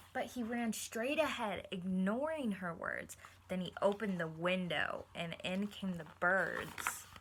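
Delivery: steady storybook read for the class; reading aloud to children. Acted. Teacher reading a picture book to her class, storybook voice. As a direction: read it like story time for kids.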